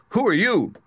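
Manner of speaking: high, loud challenge; crying out. Acted. Tin Woodman rushing at the little man, crying out. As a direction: cry out a startled demand. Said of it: "and cried out".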